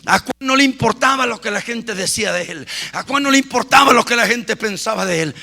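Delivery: low, gentle retelling; soft sermon narration. Acted. Preacher quietly retells John for the church. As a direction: tell John's story quietly.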